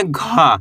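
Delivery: "घा" as voiced g with a breathy release; drawn-out, aspirated "घा"; letter घा demo. Shown as a demonstration. Teacher answering own question on घा, letter demo. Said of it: "इसको अपन क्या पढ़ेंगे".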